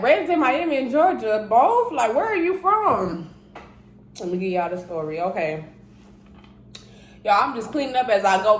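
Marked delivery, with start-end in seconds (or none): 0.0-3.3 high, loud, disbelieving question; mock-shocked audience asking where you're really from; incredulous viewers. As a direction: play the baffled viewer who can't believe it. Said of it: "And I know y'all like".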